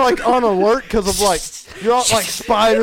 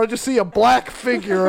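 ordinary delivery

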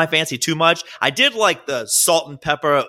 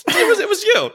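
laughing. Real, not acted. Laughing accusation between friends.

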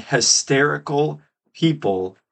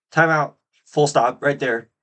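ordinary delivery

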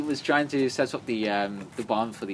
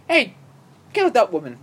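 raised, loud acted command; mock order; 9 semitones higher, 10 dB louder than usual. Retelling a film order to a friend, loud mock command. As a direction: call out a hammed-up kill order.

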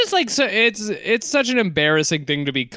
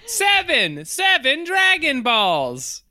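high, slow gleeful counting; mock Krillin; 9 semitones higher, much slower than usual. Cohost echoing Krillin's childish glee, high and slow. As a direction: drag it out like a delighted child.

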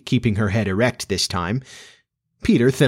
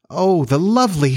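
high, admiring exclamation; delighted cry; 8 semitones higher, 4 dB louder than usual. A narrator's reading. Wendy catches sight of the fairy, crying out delighted. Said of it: "she cried".

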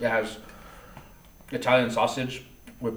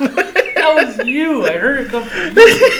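high, loud accusation through laughter; laughing imitation of an indignant denial; mock accusation; 10 dB louder, slower than usual. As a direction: pin the blame while cracking up. Retells wife accusing the other lady.

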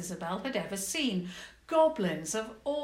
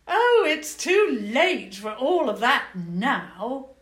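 louder, slow, airy goblin queen; dismissive queen; 5 semitones higher, 11 dB louder, slower than usual. Acted. Goblin queen dismisses the princess, slow airy voice. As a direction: brush it off lazily.

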